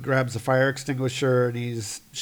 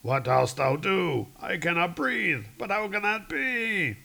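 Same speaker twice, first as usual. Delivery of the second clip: archaic, stagy alarm climbing higher; startled comic-book god; 6 semitones higher than usual. Acted. Storm god unable to breathe, stagy rising alarm. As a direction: drag out the question, then rise in alarm. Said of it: "so he's like".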